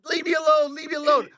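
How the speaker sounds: high, loud retold outburst; exasperated yell. Sick driver telling the hovering tourist off, high and exasperated. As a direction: push him away with a high, loud plea.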